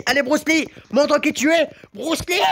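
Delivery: high-pitched cheering on; urging on. Pretend. Gamer urging Bruce Lee to show himself, high excited call. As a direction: egg the fighter on, pitched well up.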